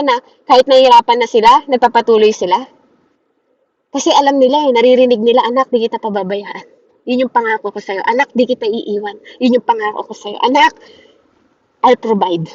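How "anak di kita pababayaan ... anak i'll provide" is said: tender reassurance. Acted. God's voice heard by believers.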